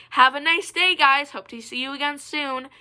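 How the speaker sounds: high-pitched mock-friendly goodbye; imitated farewell. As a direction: mimic a fake-nice farewell, pitched well up. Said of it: "I heard her say".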